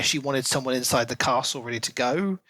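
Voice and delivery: ordinary talk, nothing marked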